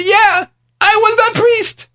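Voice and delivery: very high, slow, drawn-out admission; dramatic confession. Retold or imitated. Rich man confessing to the storyteller, high and slow. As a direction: stretch it out, pitch way up.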